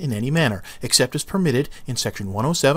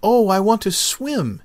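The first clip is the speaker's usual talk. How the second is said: a tiny seed dreaming out loud with a grin; wide-eyed child seed, grinning; grinning little seed; 9 semitones higher, 6 dB louder than usual. Acted. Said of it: "he said with a grin".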